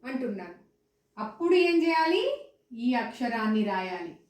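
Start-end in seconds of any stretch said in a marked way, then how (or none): none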